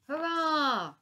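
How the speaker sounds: high, loud cheering crowd. Acted. Londoners cheering the mammoth on the ship, loud cheer.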